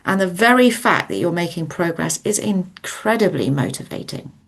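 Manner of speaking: usual speaking voice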